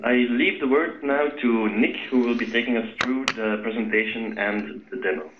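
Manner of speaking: ordinary talk, nothing marked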